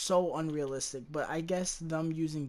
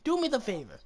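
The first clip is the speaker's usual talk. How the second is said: higher, whiny plea to be put out of misery; mock begging; 7 semitones higher than usual. As Sarah Connor, pleading with the Terminator, high whiny begging. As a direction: whimper it up high like you've given up.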